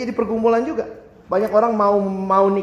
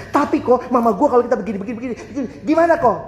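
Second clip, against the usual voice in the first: put-on much higher, louder complaining teen; whiny Jakarta teen; 4 semitones higher, 4 dB louder, much faster than usual. Teenager argues with the pastor that mom started it, high and whiny. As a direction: whine and insist it's mom's fault.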